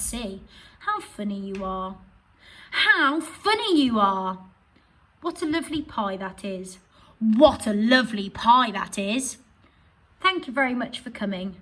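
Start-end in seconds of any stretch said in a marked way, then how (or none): 2.7-4.4 louder, higher, slow with funny stressed; exclaiming. Shown as a demonstration. Teacher contrasting the full stop and exclamation readings. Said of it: "express those sentences in a different way". 7.2-9.4 louder, rising on pie; exclaiming. Shown as a demonstration. Teacher reading the exclamation version to pupils. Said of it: "express those sentences in a different way".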